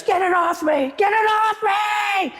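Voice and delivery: very high mock shriek, stretched out on the repeat; screaming panic. Irish client begging agent to remove spider, retold shrieking. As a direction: imitate a terrified woman shrieking. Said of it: "she starts screaming".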